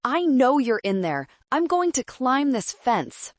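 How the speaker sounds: high-pitched threatening yell; angry scream. A narrator's reading. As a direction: shout the threat at someone hiding. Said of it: "Kyle screamed".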